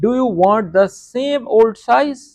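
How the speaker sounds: very slow English, word by word; teacher giving slow translation. Shown as a demonstration. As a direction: read the English meaning out slowly. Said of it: "پرانا size چاہتے ہیں".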